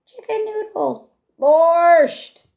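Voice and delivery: ordinary delivery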